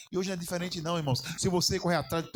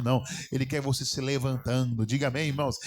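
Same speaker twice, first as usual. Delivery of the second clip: ordinary talk, nothing marked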